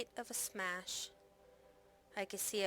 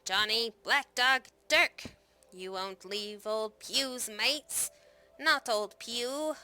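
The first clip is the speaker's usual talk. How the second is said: calling out; 5 dB louder, slower than usual. A narrator's reading. Abandoned Pugh calls after his fleeing mates, desperate loud calls. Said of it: "calling out".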